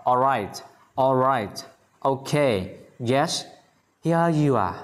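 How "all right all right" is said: "All right" with a light final t. Shown as a demonstration. Teacher drilling all right for learners, light final t. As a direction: barely release the t ending "right". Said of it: "Âm tờ nhẹ sau".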